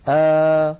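open, medium alef aa held loud; middle open alef. Shown as a demonstration. Teacher to students, showing the middle alef sound. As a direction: say آ with the mouth half open. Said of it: "هكذا، اسمعوني جيدا".

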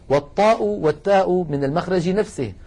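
normal talking voice

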